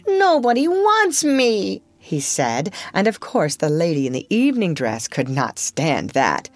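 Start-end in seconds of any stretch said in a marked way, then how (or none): 0.1-1.8 high, slow, wounded little voice; on the verge of crying; near tears. Acted. Michael to the family, hurt and near tears. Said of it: "Michael had nearly cried".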